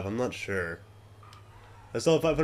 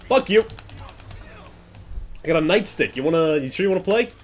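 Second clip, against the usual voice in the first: loud, higher trash-talk shouts; aggressive taunting; 6 semitones higher, 3 dB louder than usual. Real, not acted. Gamer taunting a cop he's beating, loud aggressive shouts. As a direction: goad him into a fight.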